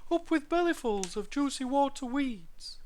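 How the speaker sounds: high-pitched duckbill dinosaur hoot; cheering hoot in a high voice. A narrator's reading. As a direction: hoot the whole chant high up. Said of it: "they hooted".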